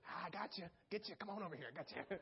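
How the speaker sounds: a light, soft-voiced devil luring you in; hushed coaxing, a hint of a scheming devil; sneaky Satan voice. Preacher acting out Satan luring a believer, lightly hushed. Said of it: "I always imagine Satan doing something like that".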